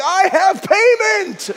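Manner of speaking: high, loud, slow laughing call; half-laughing desperate plea; frantic call. Retold or imitated. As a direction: wave it and shout desperately. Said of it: "I'm like".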